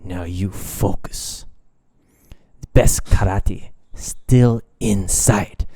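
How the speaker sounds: slow, lightly voiced calm sensei; a hint of a wise old master, slow; Mister Miyagi. Miyagi calming Daniel down, slow light imitation. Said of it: "Mister Miyagi".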